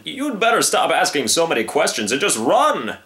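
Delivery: exasperated storybook lion; impatient old lion. Acted. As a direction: warn the youngster off sharply. Old lion scolds the young one.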